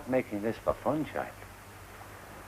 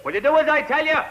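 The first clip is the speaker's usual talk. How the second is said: very high, loud scolding; angry shout; 10 dB louder, faster than usual. Acted. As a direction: bellow an order at a disobedient boy. Shouting at disobedient boy.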